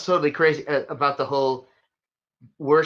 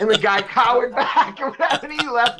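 laughing through the words, higher and louder; 8 semitones higher, 4 dB louder than usual. Real, not acted. Telling a friend the funny ending, laughing. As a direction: tell it while cracking up.

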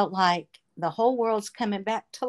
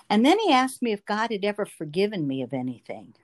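ordinary talk

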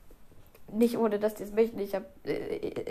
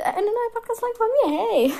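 sing-song intro voice; 11 semitones higher, 5 dB louder than usual. Pretend. Mock announcer intro, high.

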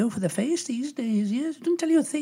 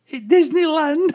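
high, slow, bad Scottish through laughter; Scottish accent; 6 semitones higher, 6 dB louder than usual. [name] giving his punchline, laughing Scottish accent. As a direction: crack up delivering the punchline in mock Scottish. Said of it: "in a Scottish accent".

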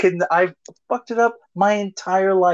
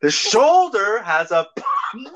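frustrated shout; 6 semitones higher, much slower than usual. Shown as a demonstration. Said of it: "can you say".

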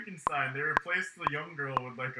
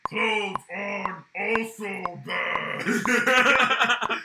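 slow, strained monster growl; 6 semitones higher, 8 dB louder, much slower than usual. Retold or imitated. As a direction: drag it out like Frankenstein. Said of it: "he's like".

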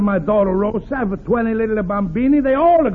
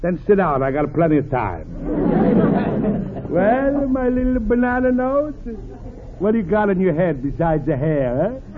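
put-on sly, booming Italian accent of a scheming friend; Italian accent, the crafty pal dropping by. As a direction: Pasquale greets Luigi in a thick Italian accent. Pasquale, Italian accent throughout.

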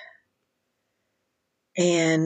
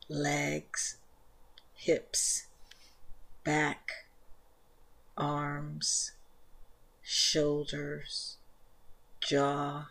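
soft, unhurried meditation voice, much softer than the intro; guided meditation; 11 dB quieter than usual. Acted. Leads guided yin yoga meditation.

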